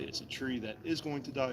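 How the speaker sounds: ordinary talk, nothing marked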